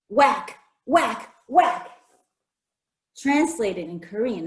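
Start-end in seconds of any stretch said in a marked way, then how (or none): none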